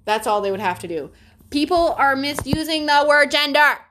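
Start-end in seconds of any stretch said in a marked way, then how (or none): 1.5-3.8 high, loud exasperated cry; exasperated outburst. Real, not acted. Ranting about gender in a high loud outburst. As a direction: let the frustration burst out. Frustrated rant.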